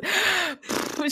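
high, sharp mock gasp of shock. Imitating Rinna on the reunion, a mock gasp. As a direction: gasp as if scandalised. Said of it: "Rinna goes".

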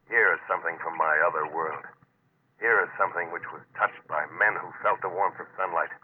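calm, measured inner narration; story narration. A narrator's reading. Diver narrating his descent to the wreck, hushed and steady.

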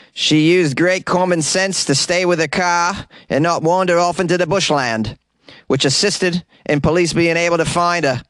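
mock Aussie sergeant voice, Australian accent; ridiculous Australian accent. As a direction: read the police quote in an over-the-top Australian accent. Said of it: "the most ridiculous Australian accent ever".

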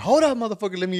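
high, loud mock yell; acted-out alarmed interruption; urgent protest. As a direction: blurt it to stop them fast. Retelling of grabbing the phone back.

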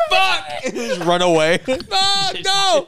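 ordinary delivery

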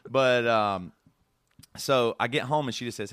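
usual speaking voice

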